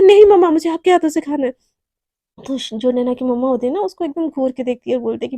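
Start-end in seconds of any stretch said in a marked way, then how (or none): 0.0-1.5 loud insisting. Acted. Naina insists to mom.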